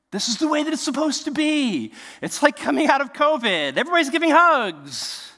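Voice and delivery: high, loud celebratory exclaiming; acted elation. Preacher picturing the gang's reunion, acted elation. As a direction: cry it out like a joyful reunion.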